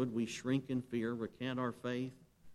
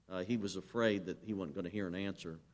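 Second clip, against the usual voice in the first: ordinary talk, nothing marked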